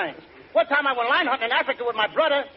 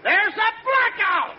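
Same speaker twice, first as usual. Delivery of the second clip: dazed shout; 6 semitones higher, much slower than usual. Acted. Dazed after a hit.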